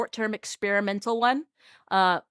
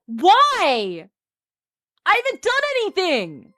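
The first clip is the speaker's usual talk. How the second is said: high, loud plaintive cry; wailed protest; 10 semitones higher, 6 dB louder, slower than usual. Acted. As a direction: protest your innocence in a high wail. Loud protest at being tormented.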